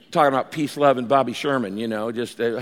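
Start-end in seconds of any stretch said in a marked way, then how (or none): none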